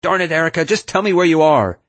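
high, loud, urgent demand; exasperated yell. A narrator's reading. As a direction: yell back in frustration. Shouting to find endangered friend.

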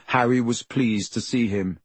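usual speaking voice

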